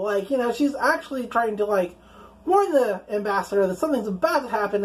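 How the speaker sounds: ordinary talk, nothing marked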